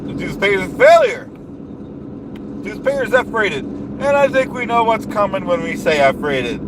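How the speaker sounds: blustering rally-speech voice, big falling endings; pompous politician rant. Acted. Comic ranting at the audience about the dues payer, blustering politician voice. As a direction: rant like a self-important demagogue at a rally.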